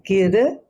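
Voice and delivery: "Gir" with the tongue flicked on the palate; kirtan first syllable. Shown as a demonstration. Teacher shows how to say "Gir" in kirtan. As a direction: voice "Gir" from behind the teeth. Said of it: "say it".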